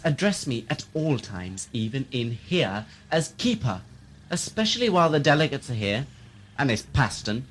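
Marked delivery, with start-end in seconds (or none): none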